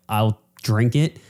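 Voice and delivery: ordinary talk, nothing marked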